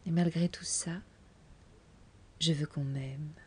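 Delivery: a soft whispered confession. Acted. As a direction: let it fall to a whisper. Aveu final chuchoté. (aveu chuchoté, tout bas)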